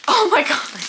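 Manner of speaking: high, loud oh my god; shocked. Real, not acted. Seeing the next book, a loud shocked squeal.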